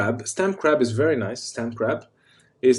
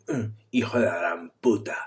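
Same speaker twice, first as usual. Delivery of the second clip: strained, held-in mock rage; neck vein going; 2 semitones lower, 4 dB quieter than usual. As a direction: squeeze the curse out as if a neck vein is bulging. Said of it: "if you have your neck vein going".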